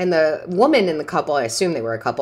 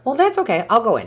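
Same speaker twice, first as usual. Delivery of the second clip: bright higher retort; 8 semitones higher, 4 dB louder, faster than usual. Retold or imitated. Her own cheerful retold reply.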